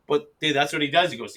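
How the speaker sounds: ordinary talk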